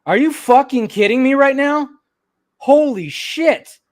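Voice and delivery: loud, high-pitched real shock; stunned disbelief. Real, not acted. Streamer reading shock news live, loud disbelief. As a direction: exclaim it, unable to believe the news. Reacting to sudden death news.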